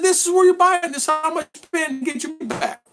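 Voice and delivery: very high-pitched retold explanation; mock exasperated explaining. Tom telling his friend where to buy a ticket, mock exasperated. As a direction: spell it out in a strained high voice.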